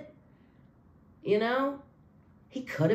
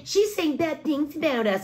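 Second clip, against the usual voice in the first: a mildly high, whiny mock voice; a light mocking impression of the chat group; 7 semitones higher than usual. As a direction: sneer it like a petty gossip. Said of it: "the group kept saying".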